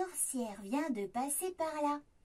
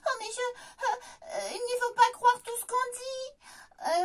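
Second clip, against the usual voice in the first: stammering; 6 semitones higher than usual. Acted. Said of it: "bafouille le petit ours".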